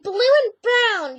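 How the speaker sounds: high, loud, slow mocking whine of a rival; mocking whiny imitation. Vlogger mocking a rival's possessive comment, shrill-free high loud mock whine. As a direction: stretch it out high and loud like a snooty girl. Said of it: "she was like".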